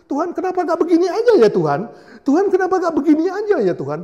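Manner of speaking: very high, loud, fast whining; hammed-up pleading believer, high and rushed; whiny complaint to God. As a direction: nag God like a spoiled child.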